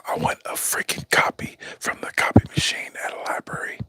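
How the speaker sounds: unvoiced whisper in a put-on creepy voice; creepy ASMR whisper. Shown as a demonstration. Creepy ASMR whisper to livestream viewers. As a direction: hush it like a creepy library ASMR request. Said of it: "like A S M R when you're, like, kinda trying to talk real creepily".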